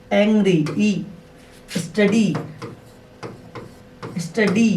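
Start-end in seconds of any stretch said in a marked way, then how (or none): 1.8-4.7 स्टडी with the final ee drawn out; stretched ee ending. Shown as a demonstration. Teacher drawing out the ee sound for the class. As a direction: hold the ee at the end of स्टडी.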